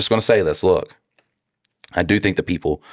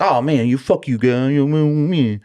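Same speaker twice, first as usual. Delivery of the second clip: put-on a higher, childish sputter of resentment; childhood self; 7 semitones higher than usual. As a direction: play your angry kid self, pitched up. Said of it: "when I was a kid, I'd be like".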